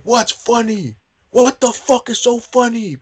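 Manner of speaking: high, loud barking yell right in his face; drill instructor yell. Retold or imitated. Drill instructor in a recruit's face, high furious yell.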